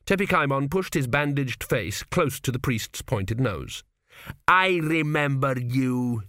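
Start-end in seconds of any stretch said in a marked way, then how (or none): 4.5-6.2 slow, drawn-out growl from the king. A narrator's reading. King to the trembling priest, slow growl. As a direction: growl slowly at the cowering priest. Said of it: "he growled".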